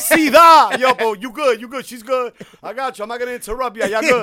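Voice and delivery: teasing passer-by voice; mock passer-by. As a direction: play the amused guy walking past. Acting the passer-by on the corner.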